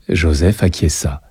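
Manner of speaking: ordinary delivery, nothing marked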